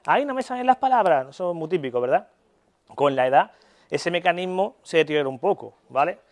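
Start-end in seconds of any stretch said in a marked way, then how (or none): 0.1-1.3 quoted grandparent. Retold or imitated. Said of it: "os habrán dicho lo de".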